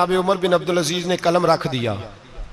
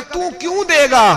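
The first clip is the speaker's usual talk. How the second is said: pitched high in disbelief; a startled, incredulous ask; astonished question; 11 semitones higher than usual. Retold or imitated. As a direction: ask why he'd give, amazed. Widow questions the governor.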